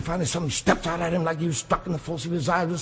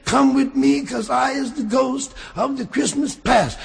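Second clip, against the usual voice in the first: booming ghost; 6 semitones higher, 4 dB louder, slower than usual. Acted. Ghost summons Scrooge.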